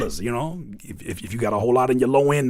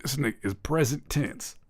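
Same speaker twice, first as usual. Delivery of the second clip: hushed joke; 3 semitones lower, 12 dB quieter than usual. Acted. Hushed joking name repeat.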